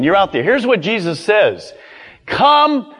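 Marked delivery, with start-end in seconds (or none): 2.2-2.9 high, forceful command. Acted. Jesus orders the fishermen to follow, high firm command. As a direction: call it out as an order, each word set apart. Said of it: "Come was a command".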